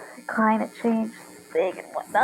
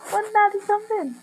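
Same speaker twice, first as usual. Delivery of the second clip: a passing hint of a British accent, pitched higher; slip into British accent; 9 semitones higher than usual. Real, not acted. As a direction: drift briefly into a light British accent. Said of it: "I slipped into British accent".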